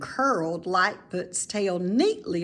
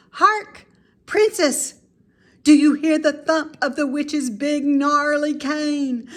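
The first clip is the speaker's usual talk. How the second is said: much higher and louder hammed-up alarm; dramatic alarm; 7 semitones higher, 7 dB louder than usual. Mrs Piggle Wiggle warning the princess of the witch, hammed-up alarm. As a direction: ham up the warning. Playing witch game, listening.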